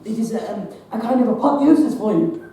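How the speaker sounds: loud, proud proclamation; hesitate, then boom out the big word; exuberant boast. Acted. Doctor telling John of his report, exuberant.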